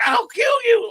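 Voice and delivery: high, loud mock shout of rage; imitated scream. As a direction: imitate an old coach screaming a threat. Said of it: "he was screaming at Cal".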